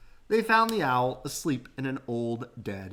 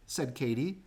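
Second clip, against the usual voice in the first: ordinary delivery, nothing marked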